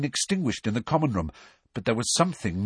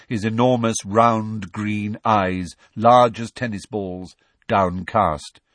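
ordinary delivery, nothing marked

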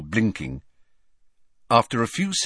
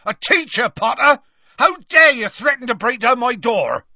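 furious full-voiced bellow, pitched way up; Hagrid roaring; 8 dB louder than usual. A narrator's reading. Hagrid scolding Harry for threatening his door, furious roar. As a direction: roar at him in outrage. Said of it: "he roared at Harry".

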